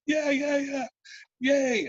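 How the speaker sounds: very high, gleeful group cheer; squeaky-high celebratory yelps; mock cheering. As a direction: imitate a roomful of eager yeses. Acting out everybody cheering the plan.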